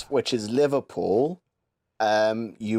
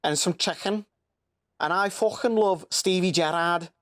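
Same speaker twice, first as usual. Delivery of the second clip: higher-pitched, thick Liverpool accent; Scouse accent; 6 semitones higher than usual. Shown as a demonstration. Showing Americans how Liverpool talks, high Scouse accent. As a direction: go up into broad, excitable Scouse. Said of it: "so Liverpool is".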